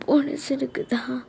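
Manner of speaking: slow, reluctant, understated weepy reply; mild tearful. Acted. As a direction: admit it while holding back tears. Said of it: "அப்புறம் ஏன்டி அழுகுற?".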